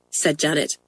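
plain reading voice

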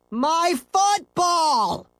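high, drawn-out anguished cry; dismayed exclamation. Acted. Scooter sees his ball vanish, slow high cry. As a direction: wail over the lost ball. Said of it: "exclaimed Scooter".

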